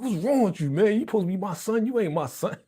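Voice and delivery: high-pitched mock dad telling off his son; laugh-tinged acted scolding. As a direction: imitate an exasperated father, amused. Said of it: "That's something a dad would do".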